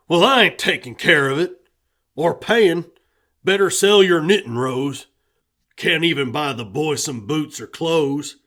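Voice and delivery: loud, fast grumbling; grumbling refusal. A narrator's reading. Husband refusing to pay for the dog, loud and grumpy. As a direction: wave the whole thing off.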